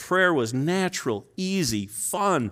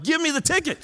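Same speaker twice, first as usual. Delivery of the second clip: pitched way up, begging for the ticket; pleading insistence; 13 semitones higher than usual. Retold or imitated. Preacher to the cop, insisting on getting a ticket, high pleading. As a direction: push hard, almost whining for the ticket.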